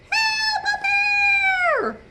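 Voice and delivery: very high "Help, a bear!"; scared scream. Acted. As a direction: shriek it like a panicked camper. Camper screaming at grizzly.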